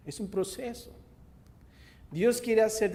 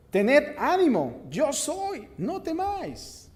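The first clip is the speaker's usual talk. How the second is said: high, slow, loud call across the water; Jesus reassuring; 10 semitones higher, 7 dB louder, slower than usual. Acted. Jesus calling to the disciples in the boat, high reassuring call. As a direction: call out to calm frightened men.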